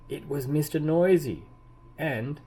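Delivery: usual speaking voice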